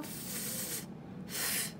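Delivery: voiceless f hissed alone; unvoiced f sound demo; isolated f sound. Shown as a demonstration. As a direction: breathe the f sound. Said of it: "say it really slowly".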